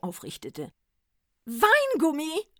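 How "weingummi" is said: high, loud, slow exclamation; rejoicing Santa cry; jubilant. A narrator's reading. Santa to the druggist, high jubilant cry. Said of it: "frohlockte der Weihnachtsmann".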